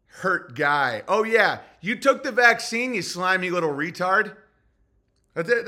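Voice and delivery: ordinary delivery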